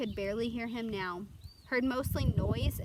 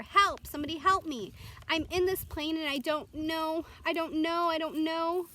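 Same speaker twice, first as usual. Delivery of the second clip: high, loud desperate cries for help; panicked screaming; 7 semitones higher, 6 dB louder than usual. Acted. Boy alone in a plane screams into the mic for help, panicked yelling. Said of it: "he began to scream into the microphone".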